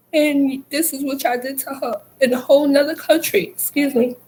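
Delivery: fighting back tears mid-sentence; voice breaking. Real, not acted. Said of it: "I'm trying not to get emotional".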